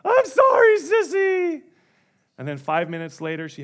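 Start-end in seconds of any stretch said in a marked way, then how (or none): 0.0-1.6 put-on very high, loud, tearful child voice; mock blubbering toddler; toddler crying apology. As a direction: whine it like a sobbing two-year-old. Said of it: "she'll be, just crying and crying".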